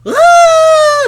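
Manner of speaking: very loud, high-pitched "aah" scream; mass panic scream. Retold or imitated. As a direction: scream like a fleeing crowd. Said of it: "Everyone's like".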